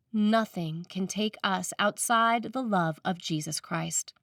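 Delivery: ordinary talk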